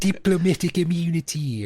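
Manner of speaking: slow, high South African villain line; South African accent. Acted. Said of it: "there's my South African accent".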